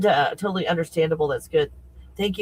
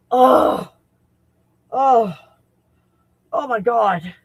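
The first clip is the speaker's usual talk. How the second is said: real thrilled exclamation; 5 semitones higher, 6 dB louder, much slower than usual. Real, not acted. Thrilled that the app worked.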